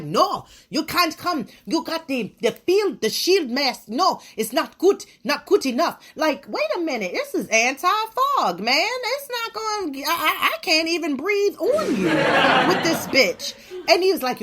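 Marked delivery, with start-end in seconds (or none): 0.0-6.0 higher, clipped-off refusal voice; mock Mister Miyagi. Second store worker turning her away, mock stern. 6.2-13.5 much higher, laughing disbelief; pushing back in disbelief; exasperated protest. Retold or imitated. Arguing with the store guy about her face shield, laughing protest.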